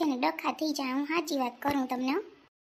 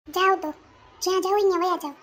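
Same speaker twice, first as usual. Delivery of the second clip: louder, higher, fed-up order; angry dismissal; 4 semitones higher, 5 dB louder than usual. Acted. Girlfriend telling boyfriend to go away, angry. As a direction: send him off angrily.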